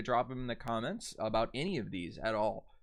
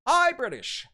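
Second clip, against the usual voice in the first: excited reveal; 10 dB louder than usual. Acted. Announcing the first dialect.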